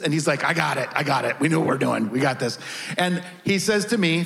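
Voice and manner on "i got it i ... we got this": grandpa's confident voice. Retold or imitated. Grandpa brushing off mom's worry.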